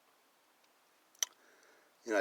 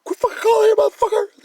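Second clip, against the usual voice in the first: very high and much louder mock dispatcher yell; fed-up dispatcher; 18 dB louder than usual. Mocking the 911 dispatcher yelling at a pest caller. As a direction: play the dispatcher losing it at a repeat caller. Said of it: "You're like".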